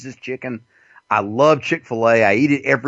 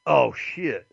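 ordinary talk, nothing marked

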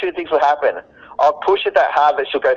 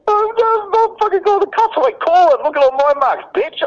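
high mock woman; 11 semitones higher than usual. Man mocking a hysterical woman calling for the cops. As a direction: squeal it like she's begging for the cops. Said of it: "she'll be like".

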